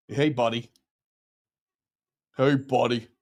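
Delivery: badly faked American accent; mock American accent. Streamer jokes that he is American, mock American accent. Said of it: "I'm not American".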